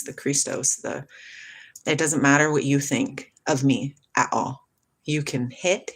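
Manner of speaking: normal talking voice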